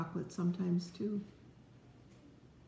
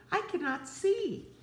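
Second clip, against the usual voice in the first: much higher, worried wail; lost, panicky cry; 12 semitones higher than usual. Acted. Parent voicing blinded Toad crying out, much higher. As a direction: Toad can't see for ice cream. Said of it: "cried Toad".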